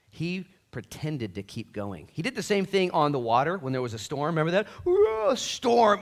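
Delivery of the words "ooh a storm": drawn-out high mock fear; slow, high mock alarm; mock spooked. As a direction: ham up the scared fishermen. Mocking disciples in the storm.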